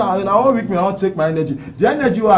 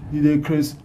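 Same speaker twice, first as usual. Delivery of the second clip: ordinary talk, nothing marked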